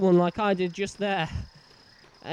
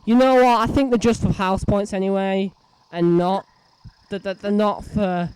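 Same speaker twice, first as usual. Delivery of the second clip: exasperated loud; 8 dB louder than usual. Real, not acted. Frustrated after failing.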